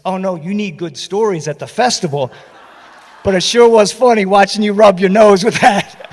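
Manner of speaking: high, loud laughing reply; laughing while quoting. Retold or imitated. An old friend teasing him, laughing loudly. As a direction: laugh through her teasing words.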